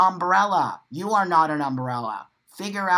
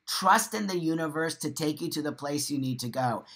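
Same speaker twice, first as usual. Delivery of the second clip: normal talking voice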